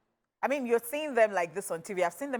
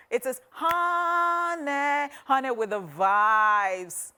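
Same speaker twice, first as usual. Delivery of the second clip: very slow, stretched-out name; drawn-out hype; 4 semitones higher, 3 dB louder, much slower than usual. Shown as a demonstration. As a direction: draw out Honey with the vibes. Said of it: "stretched name introduction".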